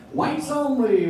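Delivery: high, sing-song machine chime. Shown as a demonstration. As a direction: chirp it out like an appliance alert. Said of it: "my impression of a racist washing machine".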